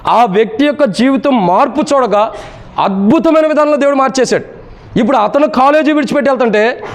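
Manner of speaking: high, sustained sermon delivery; impassioned preaching. Real, not acted. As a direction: preach it in a high, driving chant-like voice. Preaches at heightened pitch. (ఉద్వేగంతో ఎత్తైన స్వరంలో ప్రసంగం)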